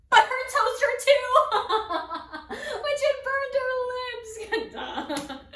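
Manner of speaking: loud, high delivery bursting into laughs; hysterical laughing. Acted. Performer tells of the kissed toaster, laughing hysterically. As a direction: barely get the story out for laughing.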